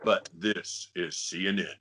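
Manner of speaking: deep, deliberate network announcer; James Earl Jones. Acted. Host prompting the voice actor with an old CNN ident, deep announcer. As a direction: drop low and intone it like a station ident. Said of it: "James Earl Jones did the voiceover".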